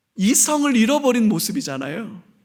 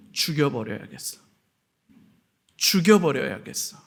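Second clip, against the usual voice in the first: mock menace; 8 semitones lower, 5 dB quieter than usual. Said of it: "바리새인들의 분노가 느껴집니까".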